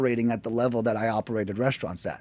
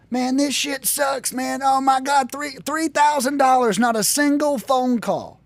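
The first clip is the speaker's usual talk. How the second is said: high, slow whining complaint; whiny complaining customer; 10 semitones higher, slower than usual. Retold or imitated. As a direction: moan like a customer who wasted money.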